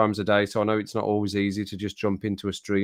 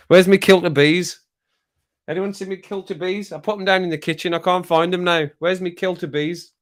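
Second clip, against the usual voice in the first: higher, playful voice hunting for his keys; mock searching bloke; 7 semitones higher, 5 dB louder than usual. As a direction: act the man who lost his kilt of bees. Acting out lost keys.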